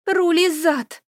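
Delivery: sharp high cursed outburst; swearing. A narrator's reading. Elis swearing after turning the corner, loud and high. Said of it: "выругалась она".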